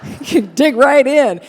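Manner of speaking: high, loud laughing tease; laughing quip. Retold or imitated. Bruce teasing the crying interviewer, laughing. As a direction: laugh it out as a joke. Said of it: "he said".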